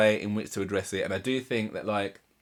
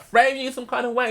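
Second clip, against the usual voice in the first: much higher, loud outraged echo of his words; incredulous angry retort; 13 semitones higher, 5 dB louder than usual. Retold or imitated. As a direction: throw his phrase back at him in disbelief. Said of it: "she's getting vexed".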